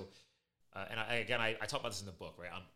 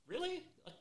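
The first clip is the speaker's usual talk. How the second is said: incredulous, much higher, hammed-up; mock disbelief; 7 semitones higher, 12 dB louder than usual. Retelling fire crew nicknames to podcast guest, mock disbelief. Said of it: "I'm like".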